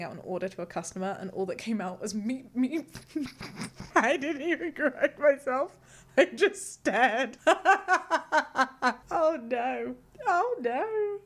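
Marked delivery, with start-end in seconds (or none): 2.2-2.9 mock blurted slip. Imitating a viewer's slip. 3.1-11.2 high loud laughing wail; laughing cringe. Real, not acted. Hearing a viewer's slip, laughing wail.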